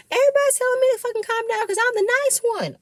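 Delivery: high, fast mock rant of Erica. Erica vents to the cast, mock shrill and fast. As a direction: squeal it out, fast and exasperated. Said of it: "Erica's trying to calm her down. She says".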